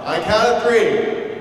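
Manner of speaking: usual speaking voice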